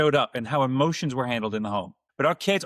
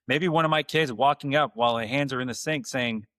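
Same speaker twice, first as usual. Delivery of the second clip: usual speaking voice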